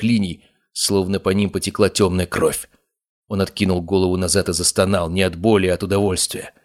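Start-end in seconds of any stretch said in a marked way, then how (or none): none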